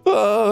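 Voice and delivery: high-pitched mock zombie cry; imitated high zombie moan; headcrab zombie wail. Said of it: "that's what they sound like".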